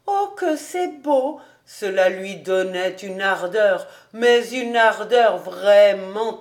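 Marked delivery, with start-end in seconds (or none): 0.1-1.4 put-on admiring girl. A narrator's reading. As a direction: breathe it out in awe. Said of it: "soupirer tout bas".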